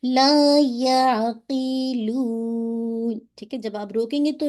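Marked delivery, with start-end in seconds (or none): 0.0-3.2 لا یعقلون very slow, the یا fatha clear and the ع merged smoothly; correct balanced ain. Shown as a demonstration. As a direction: recite لا یعقلون with a smooth, middle ع. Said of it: "یا کی حرکت کو واضح کرتے ہوئے فتحہ اور then آئین کو مرج کرنا". (لا یعقلون میں عین کو توسط کے ساتھ نرمی سے ملا کر، صحیح)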